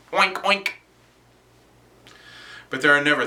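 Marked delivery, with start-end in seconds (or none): 0.1-0.7 high-pitched pig imitation; mocking pig oinks. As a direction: oink tauntingly at the cops. Mocking police as pigs.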